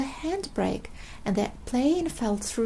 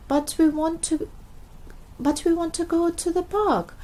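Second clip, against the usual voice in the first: crying out; 6 semitones higher than usual. Acted. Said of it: "cried Peppa".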